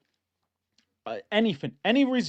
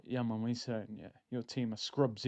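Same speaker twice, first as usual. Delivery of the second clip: low, soft, offhand boast; low-key gloating; 8 semitones lower, 12 dB quieter than usual. Acted. Arsenal fan nodding to rivals in the gym, low-key gloating. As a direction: keep it low and quiet, a verbal nod. Cocky nod to rival fans.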